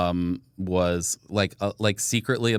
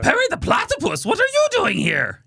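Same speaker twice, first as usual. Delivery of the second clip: high-pitched cartoon evil scientist; Doctor Doofenshmirtz; 3 dB louder than usual. Shown as a demonstration. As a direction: cry out like a cartoon villain caught by surprise. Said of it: "Doctor Doofenshmirtz, they have me do that one".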